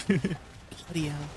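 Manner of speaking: put-on slow, drawn-out British accent; softly amused British impersonation; mock British accent. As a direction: stretch it out in a playful British accent. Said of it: "Like the, the British people do?".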